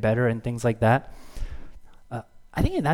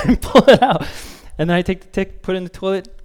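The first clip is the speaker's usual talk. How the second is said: ordinary delivery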